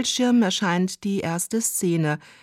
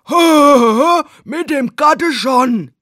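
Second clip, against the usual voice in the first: much louder, higher, slow leering yell; loud goofy leer at the housewife; hammed-up dubbed workman; 7 semitones higher, 10 dB louder, much slower than usual. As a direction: overplay the cheesy porn dubbing man.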